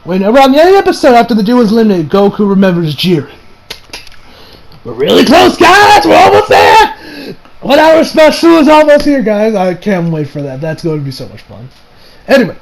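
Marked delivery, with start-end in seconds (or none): 4.8-9.5 hyped up. Retold or imitated. Hyping the next episode.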